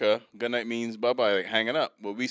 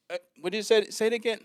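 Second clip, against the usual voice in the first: put-on high questioning girl voice; puzzled counter girl; 12 semitones higher, 3 dB louder, faster than usual. Cashier mishearing a customer's name, high puzzled imitation. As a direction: imitate her unsure guess, pitched up. Confused cashier asking again.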